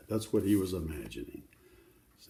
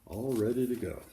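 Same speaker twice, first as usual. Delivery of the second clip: ordinary delivery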